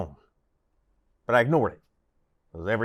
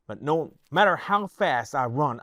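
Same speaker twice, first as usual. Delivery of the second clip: ordinary delivery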